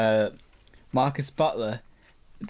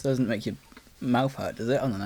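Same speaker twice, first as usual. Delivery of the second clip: ordinary talk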